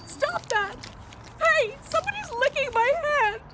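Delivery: high-pitched, upset objection; startled protest. Acted. Woman finds somebody licking her hand, startled. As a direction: react to an unexpected touch, voice high.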